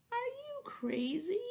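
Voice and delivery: sleepy disbelief. A narrator's reading. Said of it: "said the sleepy voice".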